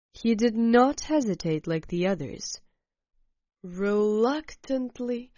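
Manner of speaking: usual speaking voice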